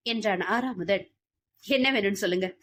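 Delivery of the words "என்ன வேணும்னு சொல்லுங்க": usual speaking voice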